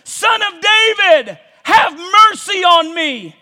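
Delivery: loud, high pleading cry; a blind beggar crying out to Jesus. Acted. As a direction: call out desperately for mercy. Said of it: "he begins to cry out".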